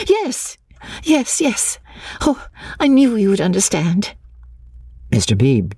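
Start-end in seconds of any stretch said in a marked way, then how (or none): 0.1-4.1 high, breaking-in agreement; excited, high-pitched yeses; eager agreement. A narrator's reading. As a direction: jump in high with relief. Excited heroine seizes on his guess.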